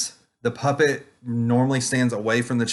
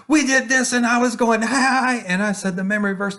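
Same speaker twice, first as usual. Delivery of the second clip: put-on much higher, louder child voice; excited kid; 12 semitones higher, 4 dB louder than usual. Kid excitedly telling the teacher about the memory verse. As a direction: play the eager little kid telling the story. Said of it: "kids come up to me and they're like".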